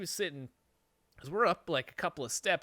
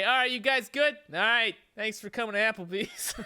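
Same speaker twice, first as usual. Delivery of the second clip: a louder, brighter mock server voice, lightly done; chipper waitress; 8 semitones higher, 6 dB louder than usual. Backup waitress brushing them off, a hammed-up cheery customer-service voice. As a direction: play the breezy waitress pretending nothing happened.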